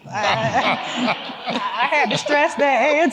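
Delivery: loud, high, laughing aside. Real, not acted. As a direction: tease a colleague through real laughter. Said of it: "I'm laughing because".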